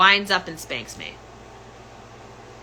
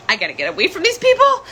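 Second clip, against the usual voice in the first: higher and fast, panicked self-quote; frantic; 10 semitones higher, 4 dB louder, much faster than usual. Retold or imitated. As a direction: blurt it out like you need to flee now. Her own panicked flight voiced.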